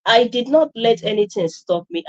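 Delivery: usual speaking voice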